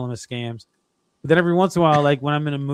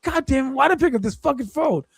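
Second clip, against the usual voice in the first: mock rant; 11 semitones higher than usual. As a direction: vent at the scam caller, half laughing. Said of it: "I lost my shit".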